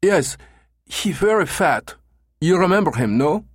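very high, broken-English German tourist; eager foreigner with a heavy German accent; thick German accent. A narrator's reading. Caller describing his brother to agency, thick German accent. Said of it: "with a thick German accent".

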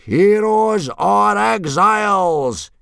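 slow, high, drawn-out roar; huge stretched-out yell. A narrator's reading. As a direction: bellow it as a rallying challenge. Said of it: "yelled Gobber the Belch".

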